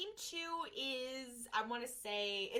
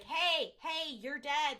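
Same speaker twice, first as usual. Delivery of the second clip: higher, louder attention-getting call; mock calling out; 4 semitones higher, 4 dB louder, much slower than usual. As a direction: call out as if rousing someone, retold. Calling to a ghost to wake.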